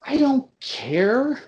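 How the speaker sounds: high, slow, drawn-out I don't care; exasperated outburst. Real, not acted. Ranting to friends about the film, exasperated high outburst.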